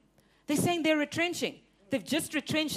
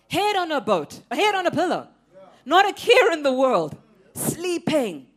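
preaching refrain; 4 semitones higher, 7 dB louder than usual. Acted. Refrain applied to Jesus.

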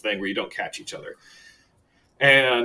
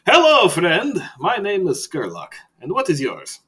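a hint of a theatrical vampire; a vampire asking with a knowing air; lightly voiced vampire; 6 semitones higher, 5 dB louder than usual. Skurlock voice continues.

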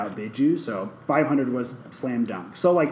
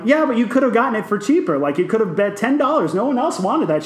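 higher, quicker, a touch of retold exasperation; exasperated arguing; 8 semitones higher, 4 dB louder than usual. To friends, retelling arguing with Hannah, mock exasperation. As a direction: argue she overpaid. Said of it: "I'm like".

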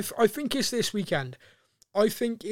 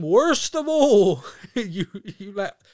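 mock furious manager; 3 semitones higher, 7 dB louder, slower than usual. Imagined manager to losing players, hammed-up angry shout. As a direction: bellow it slowly as a hammed-up telling-off.

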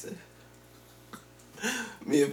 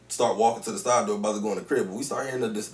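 ordinary talk

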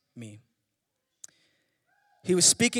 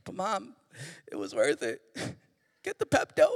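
mock whiny kid; 4 semitones lower, 4 dB quieter than usual. Kid answering mom.

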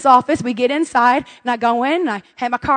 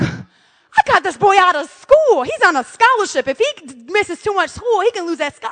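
high-pitched, drawn-out fuming protest; 5 semitones higher, slower than usual. Retold or imitated. Mom fuming over son missing school, high and worked up. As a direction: plead the case hotly, pitched high. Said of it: "I'm a little bit fuming".

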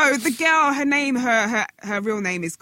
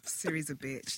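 low, very soft sneaky dig; muted, low insult; sly aside; 6 semitones lower, 14 dB quieter than usual. Pretend. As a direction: slip it in quietly under the breath. Slips in an insult about Siri.

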